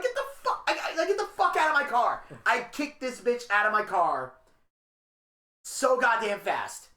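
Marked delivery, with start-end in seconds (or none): 0.0-2.2 panicked yell. Retold or imitated. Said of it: "I'm like".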